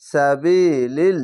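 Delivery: drawn-out chanted reading of তাকুলু, vowels held long; slow Quran recitation. Shown as a demonstration. Teacher demonstrating tajweed to students, slow chanted recitation. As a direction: recite তাকুলু slowly, stretching each vowel. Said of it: "এক আলিফ টেনে পড়বেন".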